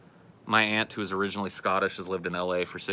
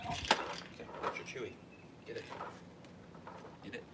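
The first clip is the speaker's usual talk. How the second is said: quiet coaxing; 4 semitones higher, 14 dB quieter than usual. Real, not acted. Coaxing dog to take its chewy.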